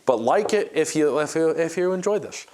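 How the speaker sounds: stumbling over repeated words; stuttering, tripping on words. Real, not acted. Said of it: "I'm stuttering a lot".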